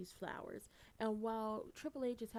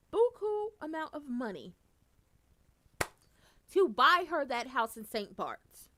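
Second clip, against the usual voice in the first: loud, slow emphatic build; slow loud outraged build; building outrage; 4 semitones higher, 11 dB louder than usual. Real, not acted. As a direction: hammer each word louder. Building outrage about house.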